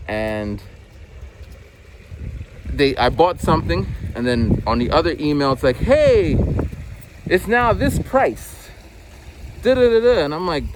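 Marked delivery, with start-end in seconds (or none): 5.8-10.2 mock sales pitch. Mocking the company's price-drop email, high and sing-song. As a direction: stretch it out like a cheesy ad.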